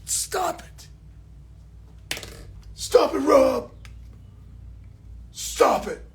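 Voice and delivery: high, put-on voice telling Rob to stop; mock fan scolding. Pretend viewer scolding the streamer in a high voice. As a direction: scold him in a silly squeaky fan voice. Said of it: "now you're like this".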